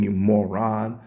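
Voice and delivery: usual speaking voice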